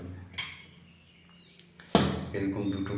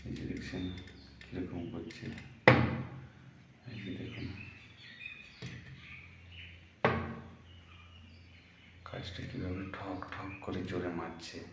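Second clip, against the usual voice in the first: soft hushed whisper so the bird stays; barely voiced, hushed narration; whispering softly. Real, not acted. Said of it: "আমি আস্তে আস্তে কথা বলছি কারণ এখন উড়ে যাবে".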